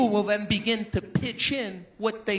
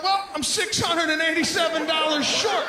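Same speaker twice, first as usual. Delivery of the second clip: louder, higher laughing protest; exasperated laughing shout; 6 semitones higher, 5 dB louder than usual. Retold or imitated. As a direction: blurt the huge number. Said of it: "you're like".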